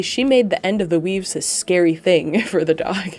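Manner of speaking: ordinary talk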